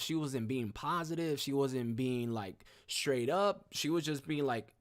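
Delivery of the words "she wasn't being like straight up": ordinary talk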